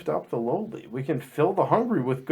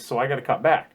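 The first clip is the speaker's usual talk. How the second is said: Wilford Brimley impression; 3 semitones lower than usual. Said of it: "Wilford Brimley and I".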